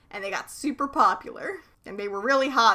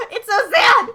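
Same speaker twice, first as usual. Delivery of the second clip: hammed-up wail of pity, high and loud; mock sadness, loud; 11 semitones higher, 11 dB louder than usual. Cohost mock-mourning the Stingers' hard winter, hammed-up loud wail. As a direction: overplay the heartbreak for laughs. Reacting to sad band backstory.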